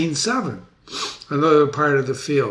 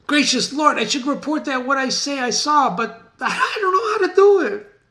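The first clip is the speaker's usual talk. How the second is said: high, stammering and half laughing; a frightened servant afraid to speak; nervous messenger; 12 semitones higher, 3 dB louder than usual. Acted. Teacher voicing nervous messenger.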